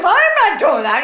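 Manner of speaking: a medic's denial, retold in laughter; laughing. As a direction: break up laughing mid-quote. Medic's reply, told laughing.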